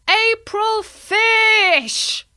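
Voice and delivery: very high, drawn-out loud call. Acted. Bertie calling out the prank reveal, high and drawn out. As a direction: stretch the call out gleefully. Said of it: "heard Bertie call out".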